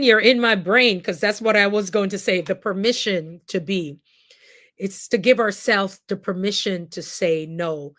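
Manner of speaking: ordinary talk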